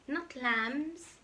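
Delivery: usual speaking voice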